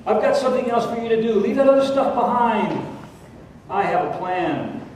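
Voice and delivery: voice of God. Acted. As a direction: speak as God nudging him onward.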